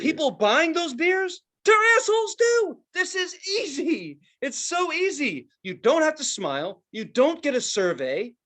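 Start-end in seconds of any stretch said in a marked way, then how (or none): 1.6-5.5 excited. Retold or imitated. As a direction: revel in how easy it is. Selling dad on the job.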